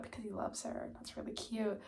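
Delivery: soft whispered gush; hushed fond aside. Real, not acted. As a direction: confide it under your breath. Cute aside about couple.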